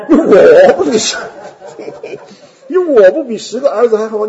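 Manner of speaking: loud, laughing through the husband's line; hammed-up husband, cracking up; husband's boast dissolving into laughter; laughing mock husband. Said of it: "他怎么安慰的呢".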